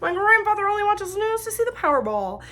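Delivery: put-on loud, high-pitched child on live TV; little kid impression. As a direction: imitate a little kid proudly addressing the camera, pitched high. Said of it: "that was a really accurate impression".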